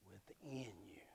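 hushed, breathy whisper; preacher whispering to the congregation. Real, not acted. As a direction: drop to a hush.

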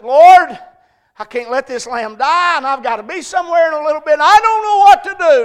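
high, shouted plea, building to a peak; desperate cry to God. Retold or imitated. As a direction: cry out to the Lord at full volume.

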